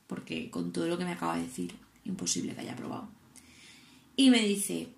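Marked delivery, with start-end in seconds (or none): 0.1-3.1 hushed dread. Retold or imitated. Asking instructor if she failed.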